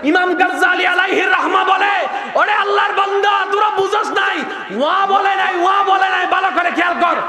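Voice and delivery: fiery preaching. Acted. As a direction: preach it with full fire to the crowd. Preaching at full force. (উচ্চস্বরে জোরালো ওয়াজ)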